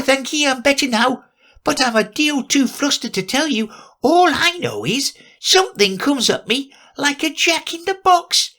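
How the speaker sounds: high, squeaky little lizard voice; weak squeak of a dazed lizard; feeble squeaky voice. Acted. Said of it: "little feeble, squeaky voice".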